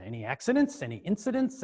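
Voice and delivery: probing New York questioning, rising high; New York accent. Retold or imitated. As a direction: quiz them like a New York mechanic. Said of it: "this kinda New York accent".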